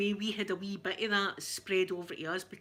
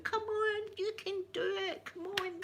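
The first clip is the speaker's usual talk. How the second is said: high, cheering crowd call; mock cheer for English runners, pitched high; cheering shout; 11 semitones higher, 4 dB quieter than usual. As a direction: urge the runners on from the roadside. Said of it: "We were shouting".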